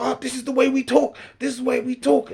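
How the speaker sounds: very high, loud defensive yell; imitating shouting. Heckler defends the way they talk, shouting. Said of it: "Why are you shouting for".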